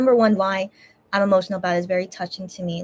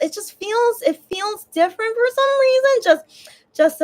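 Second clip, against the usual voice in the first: higher, cracking with tears; words catching as emotion rises; voice breaking; 12 semitones higher, 4 dB louder than usual. Real, not acted. Explaining to viewers why it feels different, voice breaking. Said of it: "I'm emotional again".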